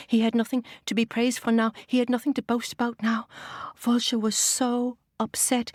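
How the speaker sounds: usual speaking voice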